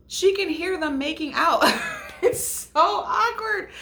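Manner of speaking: high, laughing squeal through the words; helpless giggly high voice. Real, not acted. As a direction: crack up while telling it. Cracking up at awkward retold scene.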